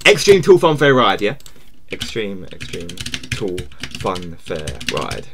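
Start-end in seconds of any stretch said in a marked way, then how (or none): 1.9-5.3 much lower, very slow trailer read; a streamer reading the game title as a trailer announcer; movie trailer voice. Shown as a demonstration. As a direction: intone it like an epic movie trailer. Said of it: "repeats title in a new voice".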